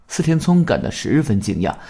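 ordinary delivery

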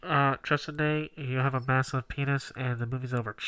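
lightly voiced PA announcer; flat echoey PA page. Mock theater PA announcement.

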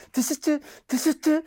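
high, sharp hushing; mother hushing the crying child, high; hissed shush. Retold or imitated. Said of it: "la palabra mágica que era la palabra tesete".

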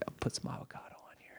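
hushed, quick offer; conspiratorial whisper. Retold or imitated. Imitating sandwich guy slipping customer free avocado, quick whisper. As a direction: whisper it like a secret favor.

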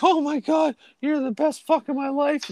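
high, gushing falsetto; mock adoring girl. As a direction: imitate a girl swooning in ecstasy. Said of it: "they'd be like".

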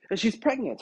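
normal talking voice

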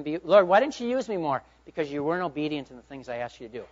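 ordinary talk

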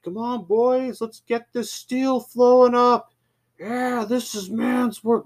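high-pitched, jovial macho hollering; showy machismo. Retold or imitated. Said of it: "showing off his machoism".

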